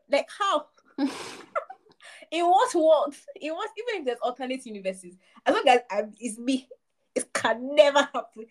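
ordinary talk, nothing marked

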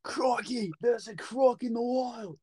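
high Australian wildlife-presenter cry; Australian accent. Acted.

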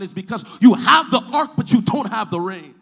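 high, loud, driving delivery; full-force preaching. Real, not acted. Preacher telling congregation what is missing, loud high-pitched preaching. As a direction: build the case urgently at full voice.

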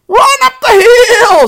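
very high, loud, drawn-out sheep cry; slow shriek with long vowels; shouting sheep. A narrator's reading. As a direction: holler like an excited sheep. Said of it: "said the sheep".

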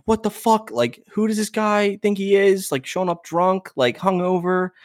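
high-pitched imitation of indignant fuming; fuming mom. Mom reacts to the trainer showing up drunk, fuming. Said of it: "my mom is fuming".

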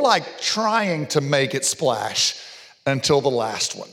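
ordinary delivery

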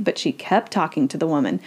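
usual speaking voice

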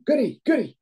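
high yell across the ice; calling from far away. Retold or imitated. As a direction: shout his name across the rink. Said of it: "I'm shouting over to him".

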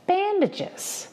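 a high, outraged shriek. Acted. Mother Hall objecting to the nurse, shrill shriek. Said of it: "Mother Hall shrieked".

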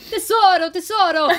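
loud, high-pitched mock endearment; hammed-up effusive arrival; gushing greeting imitation. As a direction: sing out a gushy hello.

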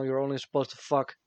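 ordinary talk

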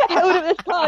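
usual speaking voice

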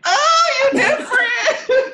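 high, loud delighted squeal; excited squeal. Real, not acted. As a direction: squeal it out, laughing. Squealing at friend lighting up.

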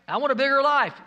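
high, loud imitation of an eager wisher; mock demanding.

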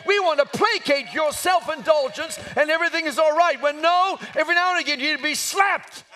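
loud, high, driving pulpit tirade; preacher rebukes fellow pulpits for compromising truth, full-force preaching. Real, not acted. Raise it to a shouted sermon climax.

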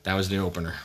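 normal talking voice

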